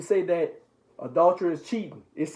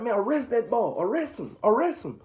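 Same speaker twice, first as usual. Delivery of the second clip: higher-pitched, insistent crowd voice; urgent mock demand; 6 semitones higher than usual. Retelling the crowd calling for Jesus's arrest, urgent and high. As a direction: act out someone urging an arrest.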